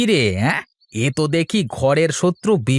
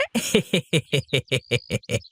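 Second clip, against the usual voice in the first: mocking snicker; 4 dB quieter than usual. Villain mocks the teacher's pupils.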